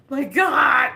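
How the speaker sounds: loud high exclamation; loud high mock shock; shocked shout. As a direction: blurt it out, overwhelmed. Retold shock.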